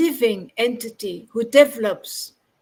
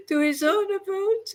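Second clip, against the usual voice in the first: laughing; 3 semitones higher, 5 dB quieter than usual. Real, not acted. Reader finishes the passage for the teacher, laughing. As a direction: get the last words out through laughter.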